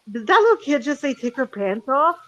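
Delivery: retold disbelieving question, rising at the end; mildly taken aback, not scolding; incredulous echo. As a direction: repeat it back as a baffled question. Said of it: "What the fuck did I just hear?".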